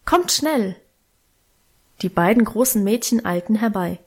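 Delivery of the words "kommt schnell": higher-pitched urgent call; mild calling voice, pitched up. A narrator's reading. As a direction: call the girls over, no real shout. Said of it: "rief sie laut".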